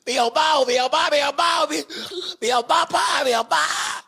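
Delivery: high, loud repeated wailing; loud outburst. Real, not acted. Worked up over how people treat her, loud outburst. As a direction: cry it out at full voice, over and over.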